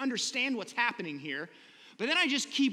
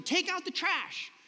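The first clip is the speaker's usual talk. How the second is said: parent command; 9 semitones higher than usual. Retold or imitated. Parent telling children chores.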